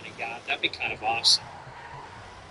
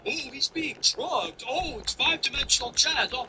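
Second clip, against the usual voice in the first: mocking excuse-makers; 9 semitones higher, 3 dB quieter than usual. Streamer parodying supporters' excuses for the politician, mocking. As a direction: parody the people making excuses. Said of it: "They'll always say".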